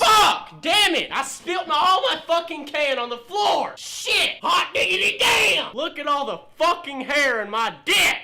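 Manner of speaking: loud, high furious cursing; angry yelling. Acted. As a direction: yell after spilling everything on the floor. YouTuber spills spit jug, yelling.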